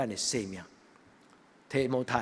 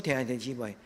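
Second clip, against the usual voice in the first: normal talking voice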